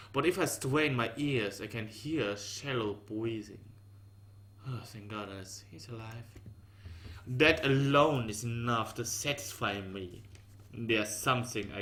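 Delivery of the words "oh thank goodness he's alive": relieved sigh. A narrator's reading. Relief on hearing breathing.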